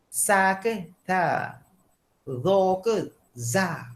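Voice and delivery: "Sa ke ta? Dha ke za?" said slowly, each letter drawn out so the Arabic sounds contrast; slow Arabic letter pairs. Shown as a demonstration. Teacher shows students the letter pairs, slow and deliberate. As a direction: spell out each Arabic letter pair carefully. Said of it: "Kat mana kita nak sebut".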